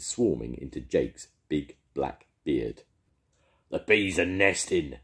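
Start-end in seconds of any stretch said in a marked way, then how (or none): 3.7-5.0 moderately raised shout. A narrator's reading. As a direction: call out the alarm to the crew. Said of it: "shouted Jake".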